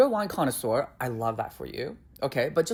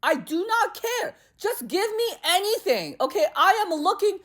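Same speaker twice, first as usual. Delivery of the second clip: much higher, louder, fed-up rant; exasperated outburst; 5 dB louder than usual. Retold or imitated. Fed up with the server, much higher louder rant. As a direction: blow up in frustration at the wine talk.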